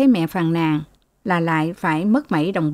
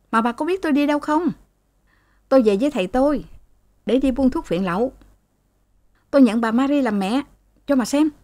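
high, fast, resentful son retorting to his mother; sulky angry son; 6 semitones higher, faster than usual. A narrator's reading. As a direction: snap back at mom, rushed and high-pitched. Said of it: "nó càng lên nước". (con trai cáu kỉnh, giọng cao và nhanh)

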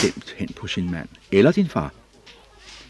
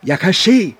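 high, loud cry of joy; healed beggar shouting he can see; joyful shout; 12 semitones higher, 11 dB louder than usual. Acted. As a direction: shout it to everyone. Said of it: "råbte han endnu højere end før".

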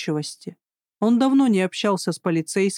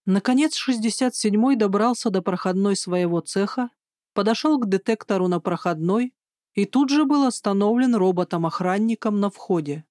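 ordinary delivery, nothing marked

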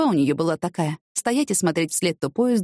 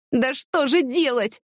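slow, high, drawn-out question; exasperated despair; 7 semitones higher, much slower than usual. A narrator's reading. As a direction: stretch it out helplessly. Stranded woman despairs, throws up hands. (с отчаянием, протяжно и высоко)

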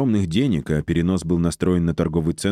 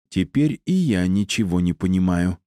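ordinary delivery

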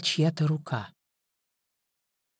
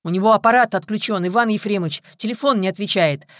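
raised, louder, higher-pitched report; desperate report; 5 semitones higher, 6 dB louder than usual. A narrator's reading. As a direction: call it out to the boss in desperation. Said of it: "отчаянно пытался дозвониться".

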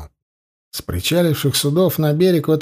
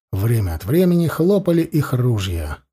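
ordinary delivery

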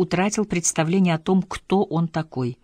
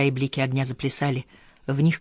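ordinary delivery

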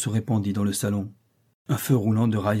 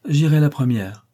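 raised, louder tragic declaration; 5 semitones higher, 6 dB louder than usual. A narrator's reading. Young lady rising to face the fortune-teller, tragic tone. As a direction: announce it with mild mock-solemn drama. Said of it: "dit-elle d'un ton tragique".

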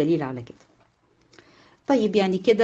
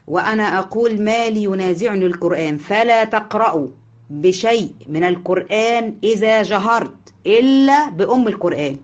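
hadith recitation; 3 semitones higher, 4 dB louder than usual. Acted.

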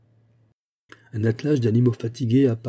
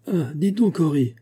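calling out; 7 semitones higher than usual. A narrator's reading. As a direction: call back across the snow. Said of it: "cria Penfield". (appel crié, bien plus aigu)

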